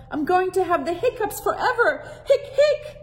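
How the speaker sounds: high, loud whimpering complaint broken by hiccups; whiny hiccuping boy. Acted. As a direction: whine that it will last forever. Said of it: "Hector whines, hiccuping".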